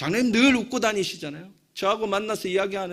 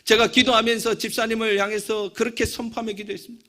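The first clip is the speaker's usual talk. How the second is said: ordinary delivery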